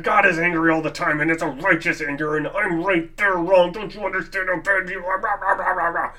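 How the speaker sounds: fast, ranting mock outrage ending in rah rah; angry listener. Angry listener scolding the preacher, fast mock rant. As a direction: rant like a self-righteous angry believer. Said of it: "another person listening to this who says".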